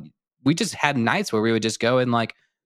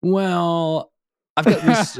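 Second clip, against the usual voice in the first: higher, hesitant and shy; nervous nerdy ask; 7 semitones higher, 4 dB louder, much slower than usual. Retold or imitated. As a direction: mumble the offer awkwardly. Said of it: "my intro to you was very similar".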